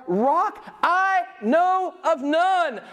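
high, drawn-out divine assertion; God. Acted. Preacher voicing God's answer in Isaiah, high, drawn-out declaration. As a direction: stretch it out high, as God dismissing rivals. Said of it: "I love it when he talks like this".

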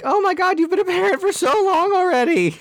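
high-pitched gasp of disbelief; shocked. Retold or imitated. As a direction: squeal it in amazement. Retold own reaction, big shift.

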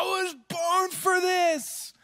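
put-on high, slow, triumphant kid's battle cry; excited little boy. Son running into freezing water, high triumphant shout. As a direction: shout it like a five-year-old charging into the sea. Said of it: "he's just gonna run into the water and go".

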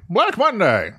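high headline cry; old-time newsboy. Acted. Host corrects the newsboy headline, high calling voice. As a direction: call out the headline like a paperboy.

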